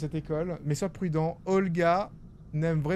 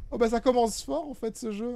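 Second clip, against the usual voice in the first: ordinary delivery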